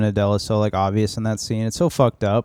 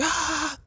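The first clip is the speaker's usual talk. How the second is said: high, soft mock scream; imitated scream; 7 dB quieter than usual. Wife finding her husband bleeding, high mock scream. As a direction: squeal a mock scream, held back in volume.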